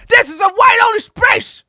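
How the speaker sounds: high, loud mocking bigot yell. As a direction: bark it like a snooty gatekeeper. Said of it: "It's like".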